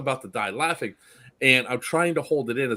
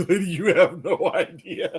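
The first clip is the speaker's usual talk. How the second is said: high, louder, through laughter; retold helpless laughing; 6 semitones higher, 4 dB louder than usual. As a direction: try to talk while cracking up. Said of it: "I'm laughing. I can't help it".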